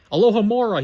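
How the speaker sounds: high, loud, falling incantation; ringing magical command; spell incantation. A narrator's reading. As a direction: cast the spell with force. Boy casts an unlocking spell.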